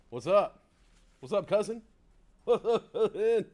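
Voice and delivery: loud, high, booming comedian greeting; Bernie Mac. Retold or imitated. Greeting his stream audience as Bernie Mac, loud booming comic voice. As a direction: hype up the crowd like Bernie Mac. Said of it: "Bernie Mac".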